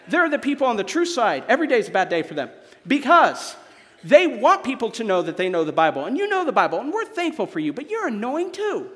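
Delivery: ordinary talk, nothing marked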